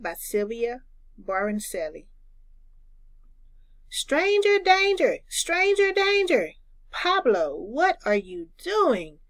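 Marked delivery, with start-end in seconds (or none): 3.9-6.5 put-on high, loud child's alarm call; shouted warning. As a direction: sing out the warning like a kid.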